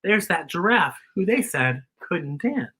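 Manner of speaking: ordinary delivery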